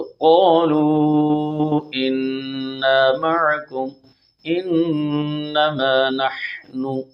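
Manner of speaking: slow melodic tajweed recitation; Quran recitation. Shown as a demonstration. Teacher reciting the verse in full for students. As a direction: chant the verse as tilawat.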